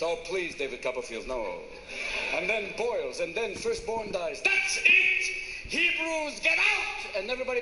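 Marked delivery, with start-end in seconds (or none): 0.0-1.6 very low, dismissive ruler; unimpressed Pharaoh. Acted. Pharaoh scorning Moses as a magician, low and dismissive. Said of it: "Pharaoh was not pleased". 4.4-7.2 high, loud mock outburst; acted angry command. Pharaoh expelling the Hebrews, loud acted fury.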